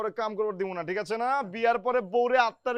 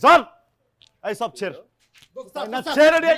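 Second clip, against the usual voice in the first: higher, louder shout to be let go; 5 semitones higher, 9 dB louder than usual. Acted. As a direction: yell while wrestling free. Struggling against being held. (উঁচু গলায় চিৎকার)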